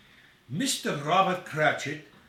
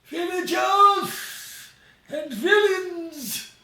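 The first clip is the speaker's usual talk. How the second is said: much higher, louder, slow booming cry; spewing proclamation; 7 dB louder, much slower than usual. Acted. Scrooge addresses the assembled crowd, bellowing high and slow. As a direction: bellow it to the whole village. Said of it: "he spews to the waiting".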